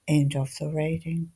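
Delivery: ordinary delivery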